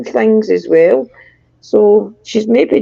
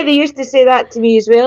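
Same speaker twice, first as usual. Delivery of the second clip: ordinary delivery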